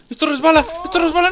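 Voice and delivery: high-pitched panic; frantic high cry; alarmed yelp. Real, not acted. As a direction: squeal as you lose your footing. Slipping in the game.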